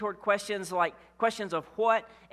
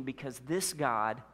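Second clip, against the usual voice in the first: soft and slow; 8 semitones lower, 7 dB quieter, much slower than usual. Acted. Soft closing of the point.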